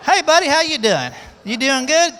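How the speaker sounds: loud teasing remark. Real, not acted. Loud remark after singing.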